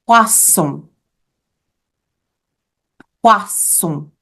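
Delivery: "Poisson" with a hissed double S, pwa-SON; S sound. Shown as a demonstration. Teacher shows class how fish sounds, S sound. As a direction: model French "Poisson" with a clear S. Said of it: "So fish sounds like this".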